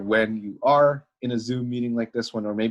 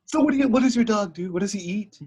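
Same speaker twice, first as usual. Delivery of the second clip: a higher, eager mock questioning girl; interrogating girl; 11 semitones higher, 3 dB louder than usual. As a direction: fire the questions at him like an interrogation. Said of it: "it's practically interrogating".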